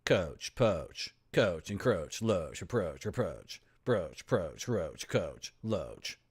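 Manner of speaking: oach rhymes listed, oh as in coach. Shown as a demonstration. Looking for rhymes, long oh list. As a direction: roll through the oh rhymes. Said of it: "What rhymes with bro".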